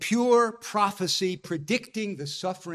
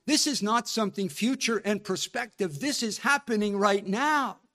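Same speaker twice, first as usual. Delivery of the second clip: ordinary talk, nothing marked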